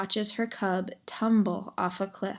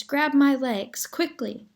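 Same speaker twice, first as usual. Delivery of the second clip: higher, louder call for help; raised-voice urgent command; urgent shout; 6 semitones higher, 4 dB louder than usual. A narrator's reading. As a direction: shout the order, pitched up. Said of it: "shouted Roz".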